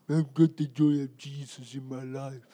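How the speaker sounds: low, soft, lifeless drone; hammed-up droopy-jawed gloom. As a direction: claim joy while sounding utterly miserable. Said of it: "need a forklift to pull their bottom jaw up".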